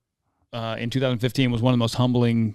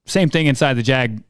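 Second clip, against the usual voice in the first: ordinary talk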